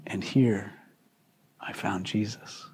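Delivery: hushed, breathy confession; whispered aside to the congregation. Real, not acted. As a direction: let it drop to a whisper. Intimate confession mid-sermon.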